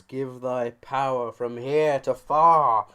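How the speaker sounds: slow, loud hammed-up invocation; mock incantation. Calling on the Lord of Stars, slow loud mock chant. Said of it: "call upon the Lord of Stars".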